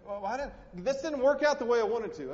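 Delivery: preacher mocking a complaining believer in a whiny voice; mock whiny excuse. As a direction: mimic someone pouting over a letdown. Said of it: "you said".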